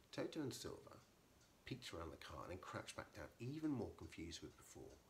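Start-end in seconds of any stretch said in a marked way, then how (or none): none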